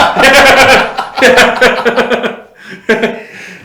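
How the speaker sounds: loud high burst of laughter; hearty laugh. Real, not acted. Cracking up over the clip, loud laughter. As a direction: laugh hard at the joke.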